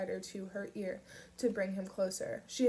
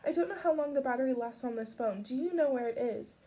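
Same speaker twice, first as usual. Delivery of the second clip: urgent; 5 semitones higher, 6 dB louder than usual. A narrator's reading. Pip urgent.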